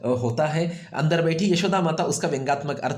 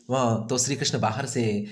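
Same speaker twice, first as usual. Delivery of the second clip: normal talking voice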